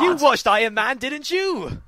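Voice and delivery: high, loud exasperated question; hammed-up incredulous telling-off; mock scolding. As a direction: demand it of a forgetful dad. Acted scolding of forgetful dad.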